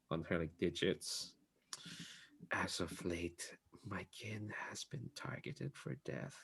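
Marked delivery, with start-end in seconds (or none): 2.5-6.4 put-on soft, cold, questioning old woman; hushed and wary, stone cold; quiet stern bee lady.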